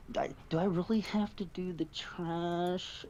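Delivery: high, slow reluctant whine; hammed-up whining; mocking whiny server. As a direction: drag it out like a spoiled employee.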